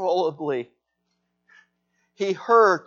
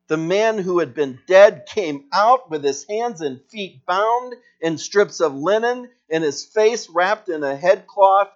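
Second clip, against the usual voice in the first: ordinary talk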